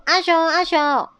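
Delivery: calling out. A narrator's reading. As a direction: call out for a missing friend. 找不到阿熊，大家呼喊. (呼喊找人)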